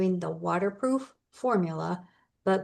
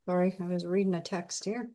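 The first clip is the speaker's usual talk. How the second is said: ordinary talk, nothing marked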